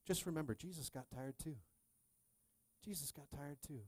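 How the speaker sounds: soft, hushed comfort; tender, fading almost to a whisper; gentle reassurance. Real, not acted. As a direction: reassure the weary very quietly. Tender reassurance to weary listeners.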